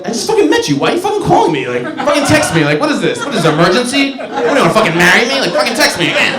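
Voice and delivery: put-on angry girl from bar. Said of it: "She's like".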